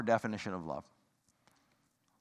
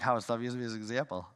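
imitating Gene; 3 semitones lower, 3 dB quieter, faster than usual. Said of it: "he's gonna give me that grin".

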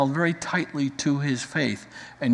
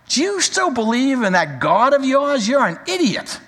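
higher, louder scornful outburst; lashing out; 10 semitones higher, 4 dB louder than usual. Retold or imitated. Wife lashing out at her suffering husband, scornful outburst. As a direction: snap at him with contempt. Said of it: "she lashes out at her husband".

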